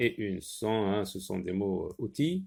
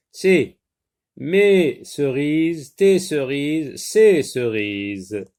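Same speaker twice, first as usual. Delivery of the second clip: slow and loud, each possessive stressed; mes, tes and ses stressed in slow, loud examples; slow emphatic examples; 7 dB louder, much slower than usual. Shown as a demonstration. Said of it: "teaching possessives".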